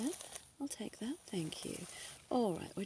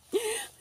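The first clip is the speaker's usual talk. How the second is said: high giggle; 8 dB louder than usual. Acted. Doctor joking about compliments.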